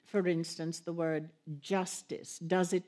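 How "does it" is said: ordinary talk, nothing marked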